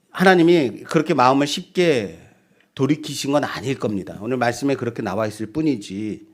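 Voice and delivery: normal talking voice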